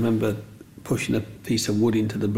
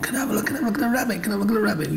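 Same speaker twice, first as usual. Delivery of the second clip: much higher, very fast pleading; eager kids; 10 semitones higher, much faster than usual. Retold or imitated. Kids crowding round to see, eager high. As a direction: tumble it out like crowding children.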